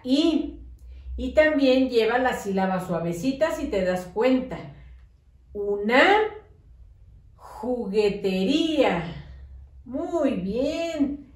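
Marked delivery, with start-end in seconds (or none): 5.5-9.0 Una, a pause, then juguetería slowly; dictation with a pause. Shown as a demonstration. Teacher dictating a long word to the class, slowly with a pause. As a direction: leave a gap after Una, draw out juguetería. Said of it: "cuando yo hago pausa".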